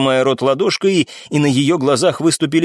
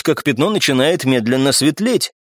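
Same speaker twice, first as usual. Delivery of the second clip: ordinary delivery, nothing marked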